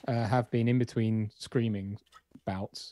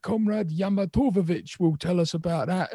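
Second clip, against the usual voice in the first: put-on northern English accent; 7 semitones higher, 3 dB louder than usual. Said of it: "sort of going like".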